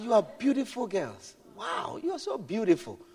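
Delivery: put-on calm the girls with quiet praise; soft, gentle praise. Preacher complimenting the unruly girls at the bank, softly. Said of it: "So I said".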